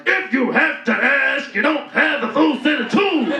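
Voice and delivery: hammed-up butch comeback.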